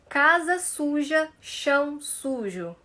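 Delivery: each syllable slow and open; slow tongue twister. Shown as a demonstration. Teacher slowing the tongue twister for students. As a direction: spell it out slowly for the class. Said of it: "precisa começar a falar devagar".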